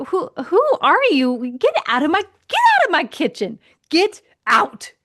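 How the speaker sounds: high, indignant ordering out; mock outrage. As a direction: throw him out in playful fury. Acted angry wife ejecting husband.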